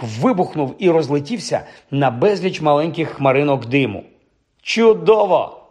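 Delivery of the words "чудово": high, exclaimed praise; delighted exclamation. A narrator's reading. Teacher praises class as boggart explodes, high exclamation. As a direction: exclaim the praise, pitched well up. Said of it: "вигукнув професор Люпин".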